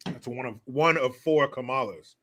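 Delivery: usual speaking voice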